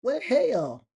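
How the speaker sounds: high, drawn-out hillbilly astonishment; hammed-up bewildered gawker; baffled country local. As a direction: swear it in a high, mock-amazed yokel voice. Gawker resumes guessing it's bears.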